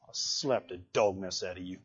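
very low, hushed mock warning; deep, barely audible dad threat; muttered mock threat. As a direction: threaten it under your breath. Father's aside answering the whiner.

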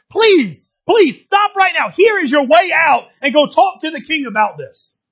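acted pleading, rising from firm to urgent; earnest appeal building to a high cry; building desperate plea. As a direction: beg with mounting pitch and force. Acts out Tamar pleading with her brother.

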